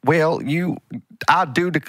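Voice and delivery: deliberately bad American Southern; bad Southern accent. Shown as a demonstration. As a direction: try out a vintage Southern accent. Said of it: "like, bad Southern".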